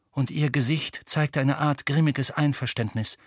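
plain reading voice

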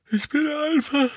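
high, loud yawned complaint; yawning. A narrator's reading. Tonks tells them she is too tired, yawning. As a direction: let the words stretch into a big yawn. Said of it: "gähnte abermals herzhaft".

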